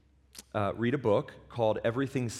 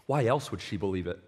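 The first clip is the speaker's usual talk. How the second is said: ordinary delivery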